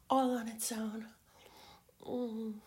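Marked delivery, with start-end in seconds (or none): 0.1-1.1 intimate teasing whisper. Acted.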